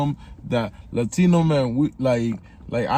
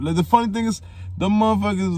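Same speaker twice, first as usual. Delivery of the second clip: amused excitement; 7 semitones higher, faster than usual. Real, not acted. Animated aside, high and loud.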